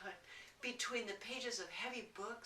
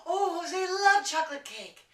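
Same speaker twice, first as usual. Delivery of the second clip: delighted gasp; 9 semitones higher, 11 dB louder than usual. A narrator's reading. Storyteller gasping with delight over the chocolate cake. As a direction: gasp then loud excited exclaim.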